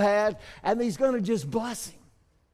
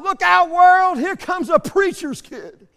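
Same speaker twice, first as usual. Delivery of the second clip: high, loud holler, slowing at the end with a laugh; loud, gleeful shout; kid hollering; 10 semitones higher, 9 dB louder, slower than usual. Retold or imitated. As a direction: yell it out like a proud little boy. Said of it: "he hollered out".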